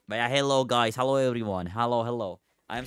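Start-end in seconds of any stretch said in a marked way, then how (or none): none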